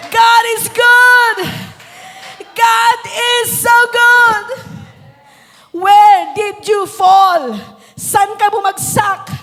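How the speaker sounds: fiery revival preaching; preaching at full force. Acted. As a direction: preach it to the rafters over music. Preaching climax continues.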